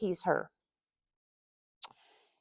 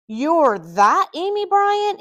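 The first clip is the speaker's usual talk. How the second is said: high, drawn-out, louder disbelief; exasperated surprise; 9 semitones higher, 6 dB louder, slower than usual. A narrator's reading. Man recognizes the woman he knocked over, exasperated surprise. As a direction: stretch it out in astonished exasperation.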